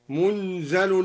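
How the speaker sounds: "منزل" as munzal, vowelless n and single z; al-Duri reading. Shown as a demonstration. Teacher isolating the variant word, "منزل" said munzal with light z. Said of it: "بإسكان النون وتخفيف الزاي".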